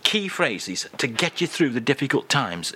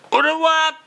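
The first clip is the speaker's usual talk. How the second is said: much higher, louder au revoir; squeaky goodbye; 14 semitones higher, 5 dB louder than usual. Acted. [name] interrupts host, high chirpy goodbye. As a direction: chirp it from off to the side.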